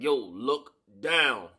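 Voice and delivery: slow, drawn-out hint; slow knowing tip-off. Retold or imitated. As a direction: let each word hang as a tip-off. Homie hinting slowly.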